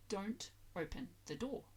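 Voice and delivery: normal talking voice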